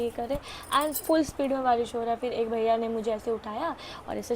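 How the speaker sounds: ordinary talk, nothing marked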